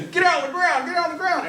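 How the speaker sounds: high-pitched yelled police order; screamed command. Shown as a demonstration. Officer coming into an office, screaming at everyone. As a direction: scream it at a roomful of people. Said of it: "I'm screaming at you".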